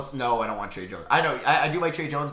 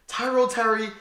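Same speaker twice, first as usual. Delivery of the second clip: normal talking voice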